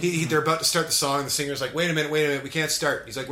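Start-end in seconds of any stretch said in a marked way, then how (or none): 1.7-3.0 raised, higher stage call; loud mock announcement from the stage; imitated singer calling out. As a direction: halt the band before the song. Retells singer halting the song.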